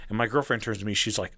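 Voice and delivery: normal talking voice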